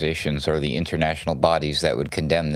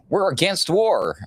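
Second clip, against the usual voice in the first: high, slow mocking quote; mocking politicians; 12 semitones higher, 4 dB louder than usual. Host mocking politicians who claimed to oppose war. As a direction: parrot the politicians in a prissy high voice. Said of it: "these people used to say".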